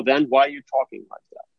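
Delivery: ordinary delivery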